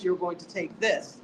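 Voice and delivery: usual speaking voice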